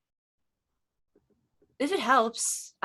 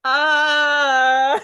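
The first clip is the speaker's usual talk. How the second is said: laughing loudly, breaking; cracking up at partner; loud laughter; 7 semitones higher, 8 dB louder than usual. Real, not acted. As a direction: lose it completely. Both actors laughing.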